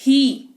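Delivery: ही with a long ee, cut off cleanly; long ee demo. Shown as a demonstration. Teacher demonstrating the long ee in ही. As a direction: stretch the ee in ही, then stop. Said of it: "कहा".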